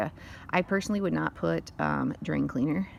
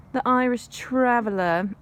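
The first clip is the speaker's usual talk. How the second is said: put-on slipping into a higher, louder, slow English accent; 6 semitones higher, 5 dB louder than usual. Vlogger drifting into an English accent while talking ancestry. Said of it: "Sorry, that was an English accent".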